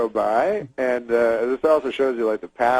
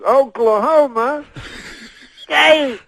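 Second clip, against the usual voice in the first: "Oklahoma State!" stretched slow, much higher and louder; said slower; 13 semitones higher, 4 dB louder, much slower than usual. Retold or imitated. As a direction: draw it out slowly and build to a shout. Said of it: "And then he says it slower".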